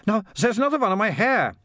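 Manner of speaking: high acted squeal of alarm; alarmed girl. A narrator's reading. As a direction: cry out as a girl with a rat in her hair. Girl finds another rat on her.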